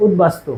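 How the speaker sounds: easy, relaxed উদ্বাস্তু as utbastu; natural pronunciation. Shown as a demonstration. Teacher showing students the natural way to say উদ্বাস্তু. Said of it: "এই যে".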